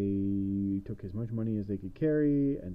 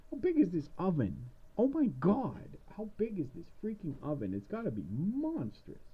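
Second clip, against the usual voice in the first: high witch voice; 8 semitones higher than usual. Acted. Witch inside giant oven.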